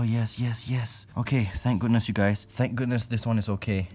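ordinary talk, nothing marked

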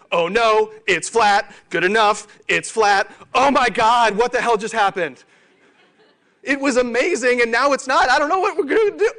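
rising from alarmed to shrill, laughing half-sob; hammed-up meltdown as results flatline; mock panic. Team watching the numbers go flat, hammed-up rising panic.

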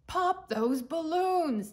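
slow, high, loud villain shout. Acted. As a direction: bellow the order at the guards. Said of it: "the Archduke shouted".

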